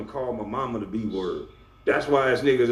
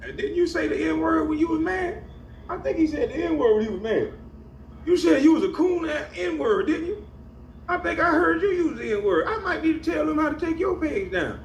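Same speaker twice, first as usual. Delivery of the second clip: very high, squeaky teasing voice; high-pitched gleeful needling; mocking taunt. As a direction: tease him in falsetto, laughter in the voice. Teasing him after the mocking imitation.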